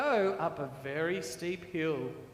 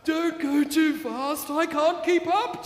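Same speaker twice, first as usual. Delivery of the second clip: tooting tractor; 7 semitones higher, 7 dB louder than usual. Acted. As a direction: plead like a small tractor falling behind. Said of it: "Tony tooted".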